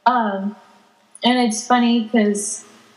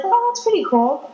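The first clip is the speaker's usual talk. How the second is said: higher-pitched mild delight; retold pleased "oh" moment; impressed reaction; 8 semitones higher than usual. Recalling first reaction to the game voices, pleasantly impressed.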